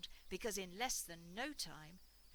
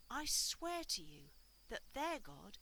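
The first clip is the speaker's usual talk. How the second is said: plain reading voice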